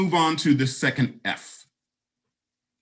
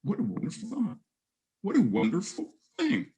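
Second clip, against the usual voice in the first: choked up; 7 semitones higher, 3 dB quieter than usual. Real, not acted. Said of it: "got a little choked up".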